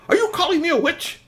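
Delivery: very high, outraged screech; hammed-up witchy outrage; angry. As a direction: screech it in high-pitched offence. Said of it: "she got really kind of angry".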